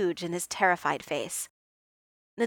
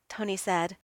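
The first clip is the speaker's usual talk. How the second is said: ordinary delivery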